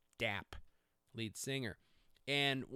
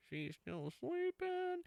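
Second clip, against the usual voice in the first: high, slow sing-song teasing; drawn out, high and mocking; high slow teasing singsong; mock sleepy voice; much slower than usual. Retelling wife's sleep, mock voice.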